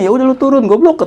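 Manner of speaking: high, annoyed telling-off; brother scolding. Retold or imitated. Brother on video call scolding him, mock angry. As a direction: snap at your dumb little brother.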